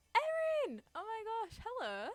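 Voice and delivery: high, loud delighted cry; excited greeting. Real, not acted. Streamer greeting a friend who joins, excited. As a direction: squeal hello at a friend who just turned up.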